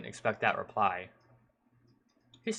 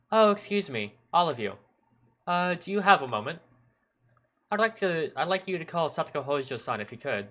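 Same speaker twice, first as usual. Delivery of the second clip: higher, louder, over-polite policeman; calling out politely; 6 semitones higher, 3 dB louder than usual. Acted. Policeman addresses schoolgirls running nearby, calling out politely. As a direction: hail them with put-on courtesy.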